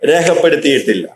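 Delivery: usual speaking voice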